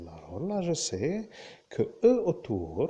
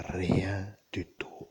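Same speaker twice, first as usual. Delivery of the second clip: slow, lower, half-whispered repeat; hushed emphasis; 6 semitones lower, slower than usual. Real, not acted. Driving home total memory loss to listeners, slow and hushed. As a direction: let each word sink in slowly, hushed.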